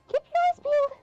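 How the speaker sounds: high, loud eager hero; character voice. Acted. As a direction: announce it like an excited adventurer. Voicing game character lines aloud.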